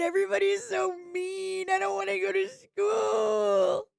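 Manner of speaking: put-on high, tearful child begging not to go to school; sobbing kid voice, high and far from her own; whiny crying kid. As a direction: wail it like a little boy pleading with mommy. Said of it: "he's like".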